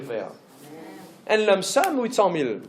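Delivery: ordinary delivery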